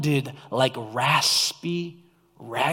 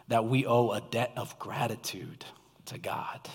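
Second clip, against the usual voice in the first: whispered emphasis; 6 semitones lower, 10 dB quieter than usual. Real, not acted. Preacher whispering to the church that we owe God thanks. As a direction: whisper it low and intimate. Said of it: "dropped to a whisper for emphasis".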